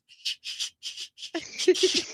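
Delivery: breathy, voiceless hissing bursts of suppressed laughter; imitated stifled laughing. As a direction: imitate a schoolboy helplessly sputtering at the nun. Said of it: "laughing, looks at the nun and goes".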